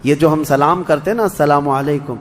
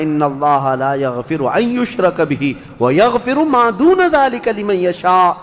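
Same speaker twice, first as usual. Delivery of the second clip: slow chanted Arabic verse; Quranic recitation; 4 semitones higher than usual. Acted. Preacher quoting the Quran to the congregation, chanted slowly. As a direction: intone the verse, lifting at the end.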